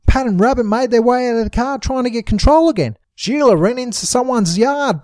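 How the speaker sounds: usual speaking voice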